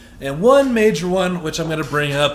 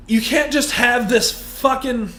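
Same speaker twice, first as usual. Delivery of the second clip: ordinary delivery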